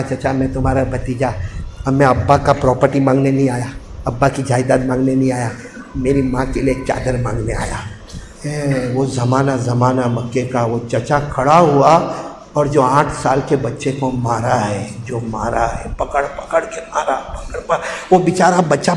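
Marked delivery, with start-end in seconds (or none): 0.0-7.8 put-on much softer, lower timid child; humble plea. Small boy begs uncle humbly. 8.4-17.8 much softer, lower, slow dread; hushed telling. Acted.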